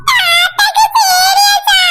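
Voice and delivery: high, slow, hammed-up wail. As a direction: stretch it into an overdone cry. Child wails over grandpa. (மிகைப்படுத்திய அழுகையுடன் உயர்ந்த குரல்)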